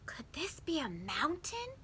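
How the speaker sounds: whispered in wonder; soft wondering whisper. A narrator's reading. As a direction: breathe the question with awe. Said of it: "he wondered".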